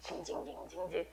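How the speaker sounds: breathless mock whine without voice; whining noise. Shown as a demonstration. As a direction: whisper a childish whine. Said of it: "징징거리는 기도는 하지 마세요". (속삭이듯 흉내 낸 징징 소리)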